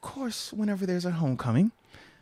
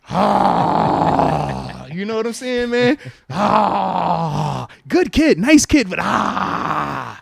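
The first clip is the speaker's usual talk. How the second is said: pained groan; 9 dB louder, much slower than usual. Real, not acted. Dad groaning about his daughter's date. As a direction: groan it out like it hurts.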